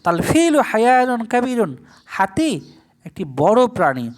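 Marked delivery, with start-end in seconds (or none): none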